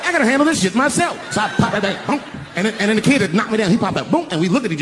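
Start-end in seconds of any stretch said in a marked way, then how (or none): 0.0-1.2 determined shout. Retold or imitated. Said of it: "I said".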